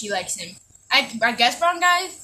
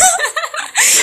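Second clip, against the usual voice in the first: shrill-pitched burst of laughter, high above her voice; real giddy high laugh; high cackle; 4 dB louder than usual. Real, not acted. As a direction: cackle hard at your own dark joke. Cackling at her own dark joke.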